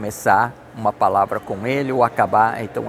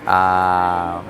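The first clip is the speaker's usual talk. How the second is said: ordinary talk, nothing marked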